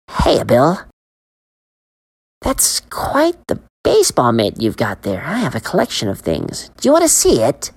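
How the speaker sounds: luring vendor. Acted. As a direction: tempt the boy in. Man luring Billy.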